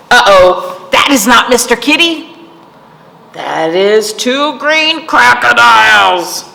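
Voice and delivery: loud storybook reveal; quick warning, then a slow, loud discovery; hammed-up uh-oh for kids; mock alarm.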